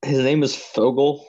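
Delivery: surprised. Real, not acted. Reacting to writer's name.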